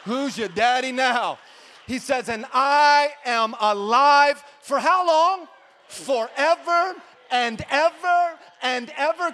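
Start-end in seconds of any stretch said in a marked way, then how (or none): 0.0-1.4 preaching shout. Acted. 2.3-9.3 high, loud, drawn-out proclamation; preaching shout. Acted. Preaching climax.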